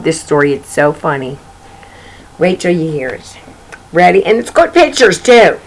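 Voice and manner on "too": ordinary talk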